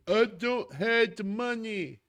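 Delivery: high-pitched whiny mock of complainers; exaggerated mocking outcry; mock screaming. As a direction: mimic people wailing, stretched and high. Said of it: "people who are out there screaming".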